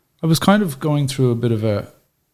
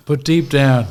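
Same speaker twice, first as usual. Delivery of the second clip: ordinary delivery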